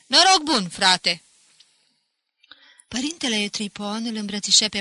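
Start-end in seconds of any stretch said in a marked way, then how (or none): 0.0-1.2 high-starting, loud reply; hearty loud greeting. A narrator's reading. Pinto answers the priest's greeting, loud and hearty. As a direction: return the hail with gusto.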